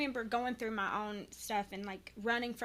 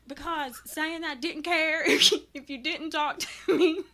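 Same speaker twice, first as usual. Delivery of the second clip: higher and louder, breaking into real laughter; laughing through words; 5 semitones higher, 8 dB louder than usual. Real, not acted. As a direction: giggle while getting the words out. Laughing while retelling apology.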